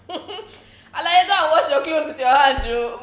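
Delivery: loud, high mocking laugh; laughing loud tease. Real, not acted. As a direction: scoff at her loudly. Mocking Dorothy's request.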